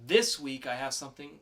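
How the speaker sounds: ordinary talk, nothing marked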